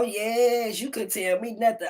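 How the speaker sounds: usual speaking voice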